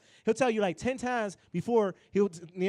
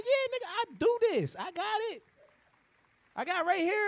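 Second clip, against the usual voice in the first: much higher, breezy and overconfident; pitched-up boastful self-quote; high cocky voice; 11 semitones higher than usual. Retold or imitated. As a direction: play it like you totally have it handled. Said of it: "and I'm like".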